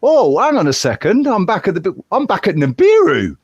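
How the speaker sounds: exaggerated bafflement. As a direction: act out a baffled double take, hammed up. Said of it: "You go".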